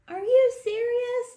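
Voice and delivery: high, loud Are you serious?; an outraged squeak of disbelief; incredulous. Acted. Reader voicing the kid's outrage at school on vacation, incredulous. Reacting to book's kid.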